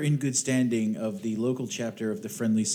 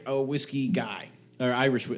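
normal talking voice

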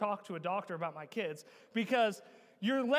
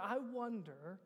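low, soft pulpit musing; quiet, reflective preaching; 6 semitones lower, 11 dB quieter than usual. Real, not acted. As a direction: wonder aloud gently to the congregation.

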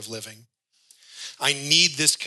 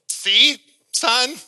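told you so; 11 semitones higher, 8 dB louder than usual. Acted. Dad after warned fall.

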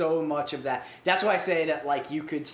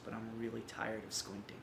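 quiet weary line; 4 semitones lower, 14 dB quieter than usual. Retold or imitated. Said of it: "takes off her glasses and starts crying".

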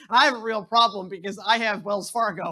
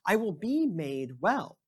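scripture reading; 5 semitones lower than usual. Acted. Pastor reading Mark to the congregation, measured scripture reading. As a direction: read it out to the congregation with care. Reads Gospel lesson aloud.

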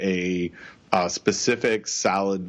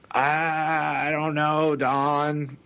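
higher, doubtful drawn-out disbelief; skeptical voice; 7 semitones higher, much slower than usual. Real, not acted. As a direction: sound openly unconvinced. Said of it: "that's my, like, skeptical voice".

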